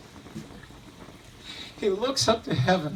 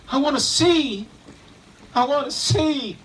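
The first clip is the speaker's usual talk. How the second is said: raised, higher plea to see; pleading blind man; 4 semitones higher, 5 dB louder than usual. Acted. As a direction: beg for sight, voice lifted. Blind man begs Jesus.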